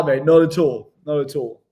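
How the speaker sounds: ordinary delivery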